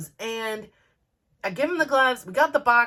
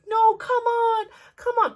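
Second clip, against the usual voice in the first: urging; 12 semitones higher, 4 dB louder than usual. Retold or imitated. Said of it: "and I'm like".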